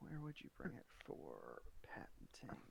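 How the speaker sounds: unvoiced whispered question. Real, not acted. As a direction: whisper it off-mic. DM whispers aside.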